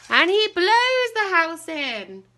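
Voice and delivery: loud, high, slow storyteller climax; big dramatic storybook moment. A narrator's reading. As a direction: belt it out as the house falls. Climax of the huffing.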